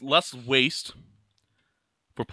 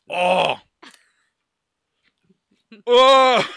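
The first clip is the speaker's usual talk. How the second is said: two loud cries of "oh", rising higher; pained yells; 6 semitones higher, 11 dB louder than usual. Real, not acted. Podcaster with mouth on fire from hot sauce, real pained yells. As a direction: yell out as the burn builds.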